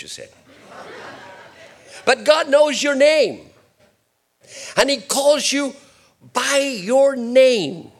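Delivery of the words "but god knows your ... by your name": loud, high, slow preaching cry; booming pulpit declaration; preaching peak. Real, not acted. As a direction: lift it and slow down on the name. Preacher rising to emphatic peak.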